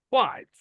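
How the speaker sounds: Quite with a high, punched first syllable; stressed syllable. Shown as a demonstration. Teacher showing students where stress falls, quite stressed high. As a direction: hit the start of quite. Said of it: "এই জায়গাটা হচ্ছে stress".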